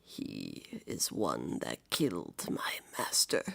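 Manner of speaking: low, slow whispered growl from a beast; low beast voice, barely voiced. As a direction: hiss the accusation low and slow like a wolf. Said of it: "this is in his voice now".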